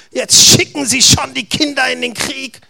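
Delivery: scornful laugh then jeering taunt; mocking giant. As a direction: sneer at the boy like a mocking giant. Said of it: "Spottete er".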